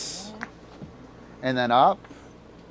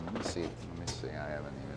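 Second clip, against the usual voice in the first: low, very soft mumble; muttered to self; 8 semitones lower, 14 dB quieter, faster than usual. Real, not acted. Streamer muttering while setting up. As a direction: mumble it to yourself.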